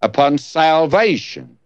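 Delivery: ordinary talk, nothing marked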